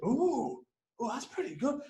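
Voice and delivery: high, soft, intrigued murmur; impressed ooh. Retold or imitated. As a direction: react softly, as an idea lands. Retold impressed reaction to dad.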